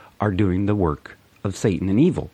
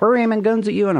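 even higher, loud threatening voice; mock China; 5 dB louder than usual. Vlogger voicing China, higher still and loud. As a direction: shout it up high like a cartoon rival. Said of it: "China's like".

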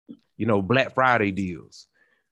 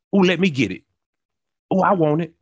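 put-on higher-pitched eager kid claiming it first; eager grabbing; 6 semitones higher, 3 dB louder, faster than usual. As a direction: blurt it out, lightly acting a child who wants it. Acting eager kids grabbing last Gatorade.

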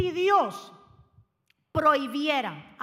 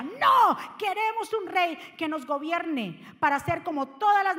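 crowd demanding; 2 semitones higher, 3 dB louder than usual. Acted. Voicing Israel's people demanding a king, shouted. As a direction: bark it like a stubborn crowd.

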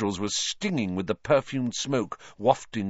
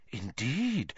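hushed; 3 semitones higher, 6 dB quieter than usual. Acted. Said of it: "whispered Professor Trelawney".

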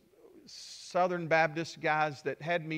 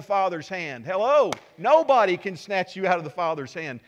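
louder and higher, rousing the crowd; full-force preaching; 4 semitones higher, 8 dB louder than usual. Acted. Pastor rouses the congregation about God's hand, loud preaching. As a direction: preach it out, fired up.

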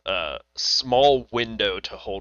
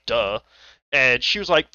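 ordinary delivery

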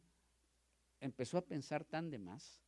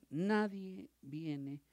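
nadie stretched slow and high; slow, raised emphasis; stress on nadie; 6 semitones higher, 7 dB louder, much slower than usual. Acted. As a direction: lean hard into nadie. Preacher stresses nobody came.

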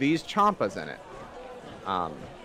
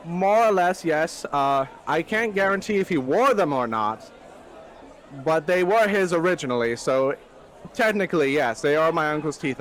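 eccentric character voice; 4 semitones higher than usual. Acted. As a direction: play the odd gentleman proudly. Character answering in put-on voice.

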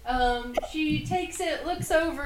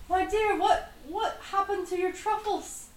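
concerned little man; 7 semitones higher, 5 dB quieter than usual. Acted. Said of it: "he sort of is like".

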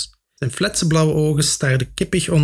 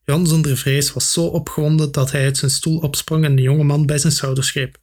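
plain reading voice

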